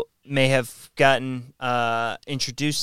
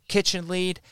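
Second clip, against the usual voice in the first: usual speaking voice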